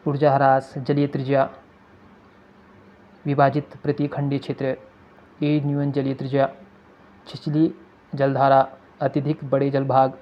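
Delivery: keywords picked out one by one with long gaps; skimming demo. Shown as a demonstration. Teacher shows students how to skim a passage, keyword by keyword. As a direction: read only the key words, pausing between each like a reader skimming. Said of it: "हम पढ़ेंगे जलधारा".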